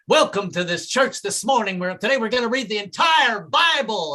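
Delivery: preacher yelling. Retold or imitated. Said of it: "slap the Bible and yell constantly".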